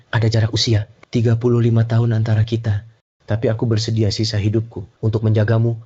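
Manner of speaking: mock-tender proposal recital; milk every sappy line for the crowd; theatrical letter reading. Rival reading the proposal aloud to embarrass the director, mock tender.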